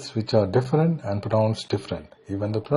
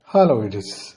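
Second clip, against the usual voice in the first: usual speaking voice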